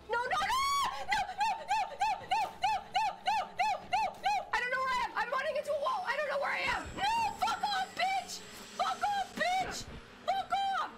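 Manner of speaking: very high frantic shrieks; panicked screaming. Real, not acted. Streamer lost and running into walls, screaming in panic.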